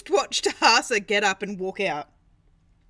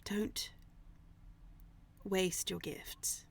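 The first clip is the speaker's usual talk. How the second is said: whisper; 2 semitones lower, 14 dB quieter, much slower than usual. Acted. GM voices NPC parting advice.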